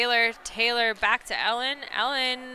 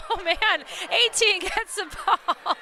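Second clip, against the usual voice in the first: laughing excitement; 7 semitones higher than usual. Real, not acted. Laughing after the near miss.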